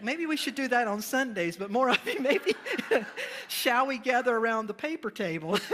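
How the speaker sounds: joking through laughter, loud; loud laughing joke to the pews. Real, not acted. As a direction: tease the congregation with a laugh. Joking to the congregation.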